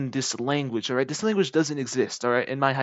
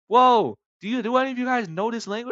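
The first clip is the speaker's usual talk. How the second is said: high-pitched, loud opening then quick question; baffled appeal; 9 semitones higher, 6 dB louder than usual. Acted. Owner asking his employees about a customer's language, baffled appeal. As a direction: act out a startled call for help.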